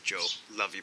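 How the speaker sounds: ordinary talk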